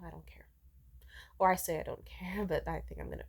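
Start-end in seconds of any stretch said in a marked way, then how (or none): 0.1-0.5 soft breathy whisper of not caring; whispered shrug. Real, not acted. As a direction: whisper it to yourself, hurt. Hurt, claims not to care.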